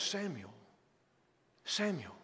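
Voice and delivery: a still small voice calling; soft calling voice. Acted. God's voice calling the boy.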